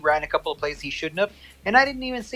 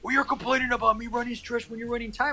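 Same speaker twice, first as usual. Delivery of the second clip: mild mock indignation, pitched up; mad; 5 semitones higher, 4 dB quieter than usual. Opponent accusing him of complaining, mildly mad. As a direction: imitate an opponent's higher-pitched griping. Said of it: "and then he got mad".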